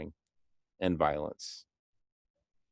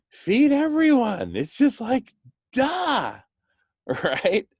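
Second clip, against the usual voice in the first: gleeful exclaiming; 12 semitones higher, 5 dB louder, slower than usual. Real, not acted. Pitching the obvious idea excitedly.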